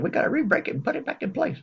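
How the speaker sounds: high, strained put-on voice of the doctor; mock doctor voice. The doctor greeting the patient in a high mock voice. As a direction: squeeze the voice up high to play him. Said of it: "he said".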